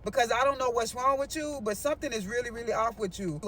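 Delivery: low, soft, restrained impatience; retold dismissal, pitched low and held back; impatient friend. Friend sending her home to calm down, quietly impatient. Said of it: "my friend getting very impatient with me".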